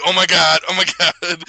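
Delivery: loud, high excited gasp of oh my God. Retold or imitated. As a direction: blurt it out thrilled and loud. Excited reaction in theater.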